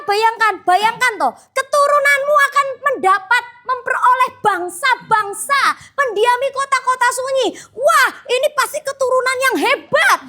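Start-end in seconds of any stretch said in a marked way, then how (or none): 1.5-10.2 high, impassioned sermon delivery; fervent preaching. Acted. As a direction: preach it out with rising fervor. Preaching at full force.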